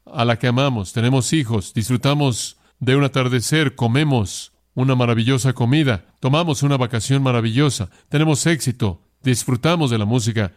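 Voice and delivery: ordinary talk, nothing marked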